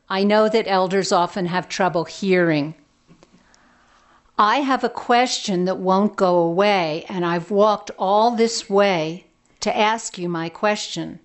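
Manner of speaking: loud, deliberate, as if to someone hard of hearing. Acted. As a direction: raise the voice for a deaf old woman. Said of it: "I'll speak louder".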